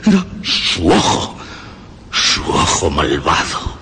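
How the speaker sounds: susurrado, lento y sombrío; susurro obsesivo. Acted. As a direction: susurra como quien confiesa una obsesión. Narrador obsesionado con el ojo. (narrador que susurra su obsesión por el ojo, lento y siniestro)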